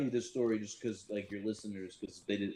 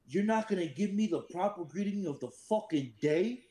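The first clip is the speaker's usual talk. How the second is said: higher, barked demand; stern sergeant major; 7 semitones higher, 3 dB louder than usual. Retold or imitated. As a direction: bark it like an angry sergeant major. Sergeant major barking at a Marine.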